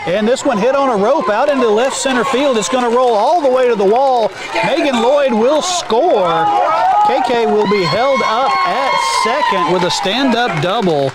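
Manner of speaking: excited call. Real, not acted. Big hit called live.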